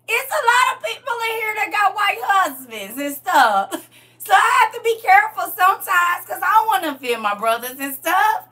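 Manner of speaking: high, put-on Southern twang; hammed-up country Southern relative.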